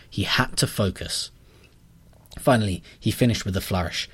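plain reading voice

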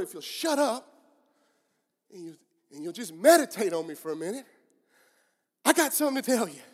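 loud, exasperated God cutting in; exasperated shout. Acted. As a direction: raise your voice to demand attention, acting it out. Preacher voices exasperated God cutting in.

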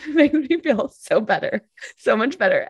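high laughing relief. Real, not acted. Mother laughing to a friend about her clingy son, high and laughing.

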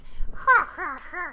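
imitated evil laugh. Co-host showing what clowns always do, mock evil laugh. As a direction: do a cartoon villain laugh. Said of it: "like, evil laugh thing".

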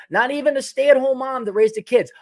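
normal talking voice

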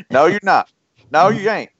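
high, insistent Texan rebuttal; hammed-up defiant denial; mock contradiction. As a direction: imitate an old booster flatly forbidding it. Voicing a contradicting Texan booster.

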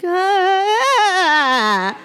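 high strangled panicked wail. Retold or imitated. Woman answering a kind stranger with a high panicked wail. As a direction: let out a high, helpless panic cry. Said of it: "And I'm like".

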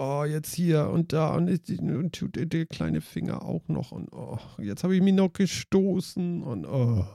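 slow, soft, self-pitying moan; man flu whining. Retold or imitated. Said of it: "für Männergrippen".

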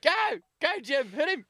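high, loud fight-crowd cheer; high, loud ringside call. Pretend. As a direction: egg him on from the crowd.

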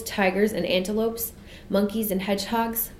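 usual speaking voice

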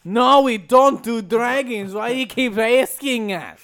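irritated put-on French accent; exasperated mock French accent. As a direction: snap back in a fake French accent. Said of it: "you sound more and more Russian".

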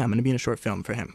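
ordinary talk

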